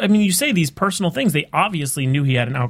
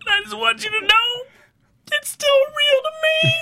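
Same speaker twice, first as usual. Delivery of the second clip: very high, hammed-up crying voice; mock bawling. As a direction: imitate a grown fan wailing, drawing out the words. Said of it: "cry bawling".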